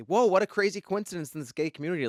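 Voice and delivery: loud, high retold "whoa"; amazed reaction. Explaining to an upset ex it was just coincidence, amazed.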